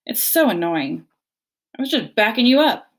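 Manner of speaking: loud, whiny protest; squabbling sibling bear; bickering bear. A narrator's reading. Bears bicker.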